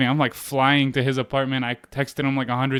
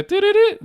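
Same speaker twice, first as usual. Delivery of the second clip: very high, loud electronic beeps by mouth; imitated phone error tone; 5 dB louder than usual. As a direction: sing out a disconnected-number tone. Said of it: "I get hit with the".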